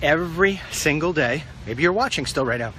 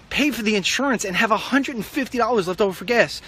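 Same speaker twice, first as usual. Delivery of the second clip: indignant; 7 semitones higher than usual. Real, not acted. Indignant rant about costs.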